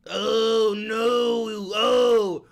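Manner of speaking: loud drawn-out wail; mock alarm. Streamer reacting to his fake news, mock wailing. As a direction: wail it in hammed-up dismay.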